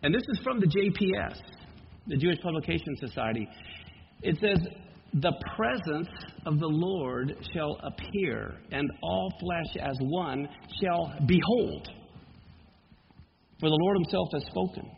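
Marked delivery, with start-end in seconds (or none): 5.1-14.9 slow scripture reading. Acted. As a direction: lower and slow, solemn reading. Reads JPS translation.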